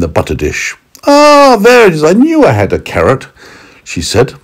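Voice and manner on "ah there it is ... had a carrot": high, loud pleased lady voice; a cheery high-pitched housewife finding her carrot; lady of the house. Acted.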